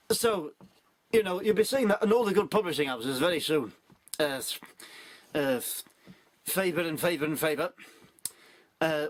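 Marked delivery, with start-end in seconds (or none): none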